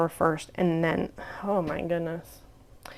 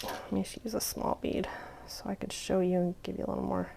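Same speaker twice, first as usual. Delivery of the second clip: whispering; 11 dB quieter than usual. Real, not acted. Muttering while she works.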